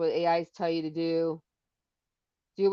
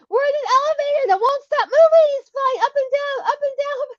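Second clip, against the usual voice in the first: high, loud panicked wail; frantic high-pitched scream re-enacted; 8 dB louder than usual. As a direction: shriek for help from inside a runaway elevator. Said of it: "And I was screaming".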